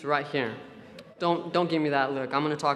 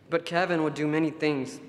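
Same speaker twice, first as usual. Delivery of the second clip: ordinary talk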